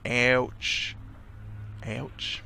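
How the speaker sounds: testing a southern "ouch"; attempted southern accent. Shown as a demonstration. Player practising a character's ouch, tentative southern accent. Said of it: "trying to remember what southern accent sounds like".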